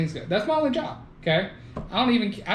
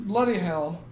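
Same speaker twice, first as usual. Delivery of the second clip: put-on Australian exclamation; mock Aussie accent; slower than usual. Shown as a demonstration. Trading accent attempts with friend, in mock Aussie. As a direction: try a fake Aussie curse. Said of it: "You said Aussie".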